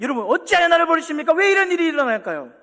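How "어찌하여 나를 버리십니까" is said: high, loud anguished cry; Jesus crying out on the cross. Acted. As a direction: cry out abandoned, in agony.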